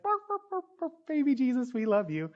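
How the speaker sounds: put-on very high yappy bark, like a child; little girl barking. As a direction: bark like a small puppy. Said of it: "It's like".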